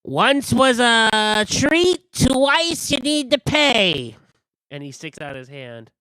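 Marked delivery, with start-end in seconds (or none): 0.0-4.2 high, loud, drawn-out singsong warning; slow high merchant voice demanding payment; Chucklehead, slow and sly. Acted. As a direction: stretch every word like a teasing shopkeeper. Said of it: "My name's Chucklehead".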